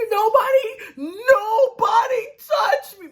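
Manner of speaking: very high, loud drawn-out mock scream; losing it. Michael melting down as they grab him, losing it. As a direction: imitate a hysterical wail, stretched out. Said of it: "Michael is losing it".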